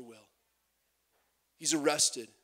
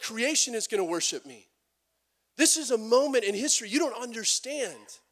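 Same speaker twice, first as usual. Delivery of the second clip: higher and louder, a bold declaration; Jesus defiant; 6 semitones higher, 5 dB louder than usual. Retold or imitated. Jesus answering the Pharisees, raised and commanding. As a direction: proclaim it with confident authority. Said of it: "basically what he's saying is".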